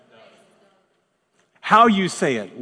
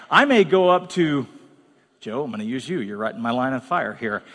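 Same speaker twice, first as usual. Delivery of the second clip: ordinary talk, nothing marked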